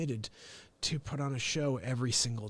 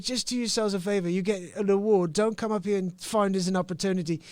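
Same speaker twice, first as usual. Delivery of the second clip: Ricky Gervais; 4 semitones higher, 3 dB louder than usual. Retold or imitated. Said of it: "He's like".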